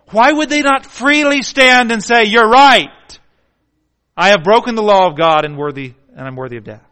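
loud, high preaching cry; full-force preaching. Acted. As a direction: call it out hard.

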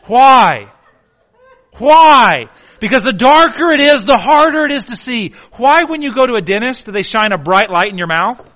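loud, high preaching shout; full-force preaching. Acted.

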